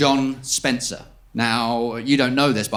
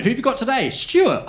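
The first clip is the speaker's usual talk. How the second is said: government eavesdropper; 11 semitones higher than usual. Acted. Government listeners discussing who they spy on, high put-on voice. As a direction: call it out in a raised mock voice.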